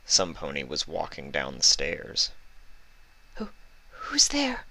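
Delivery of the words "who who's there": high, breathless frightened whisper; Fluttershy whispering into the dark, afraid; nervous whisper. Acted. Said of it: "Fluttershy called out nervously".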